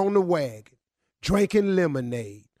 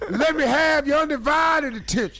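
hype shout; 7 semitones higher than usual. Acted. Hype man repeat.

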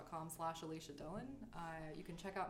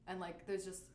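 usual speaking voice

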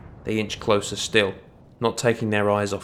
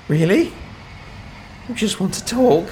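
high, frantic questioning; 11 semitones higher than usual. A narrator's reading. Dark elf on the roof edge questioning the watchman, frantic. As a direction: ask it high and disbelieving. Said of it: "he asked frantically".

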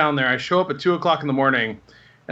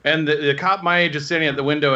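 ordinary delivery

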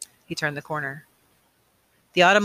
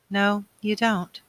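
slow, higher-pitched wonder; in awe; 4 semitones higher, slower than usual. A narrator's reading. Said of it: "she said in awe".

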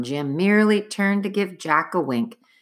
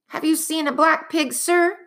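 out of breath; 10 semitones higher than usual. Acted. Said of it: "out of breath".

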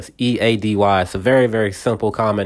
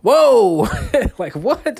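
startled whoa, much higher; taken aback; 11 semitones higher, much slower than usual. Retold or imitated. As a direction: caught off guard and thrown. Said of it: "He's like".

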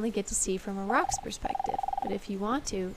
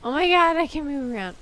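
excited; 6 semitones higher, 8 dB louder than usual. Real, not acted. Thrilled at becoming a rock.

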